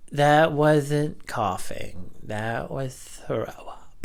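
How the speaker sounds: slow, dropping to a whisper; telling the boss it was vomit, half-whispered; awkward confession. Retold or imitated. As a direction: confide it awkwardly, trailing to a whisper. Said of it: "repeating to boss".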